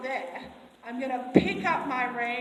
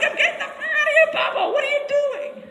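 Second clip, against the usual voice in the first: panicked horse; 12 dB louder than usual. Retold or imitated. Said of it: "it's like".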